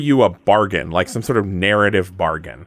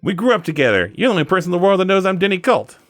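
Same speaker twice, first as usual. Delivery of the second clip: put-on accidental Southern accent, higher and fast; a hint of a Southern accent, pitched up; 9 semitones higher, faster than usual. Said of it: "I'm Southern all of a sudden".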